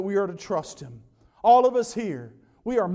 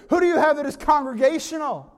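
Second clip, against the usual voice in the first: mock call to Saint Peter; 6 semitones higher, 4 dB louder than usual. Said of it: "mocked this idea of God".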